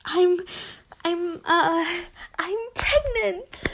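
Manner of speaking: high, halting blurt; blurted confession. Acted. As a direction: force the words out, nervous. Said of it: "Septimus blurted out".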